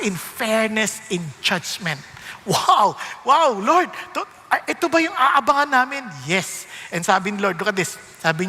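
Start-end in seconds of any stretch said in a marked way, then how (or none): 2.5-6.0 louder delighted exclamation; excited wow. Real, not acted. Pastor marvelling at God's promise, loud excited wow. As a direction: burst out in amazement.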